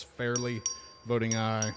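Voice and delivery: usual speaking voice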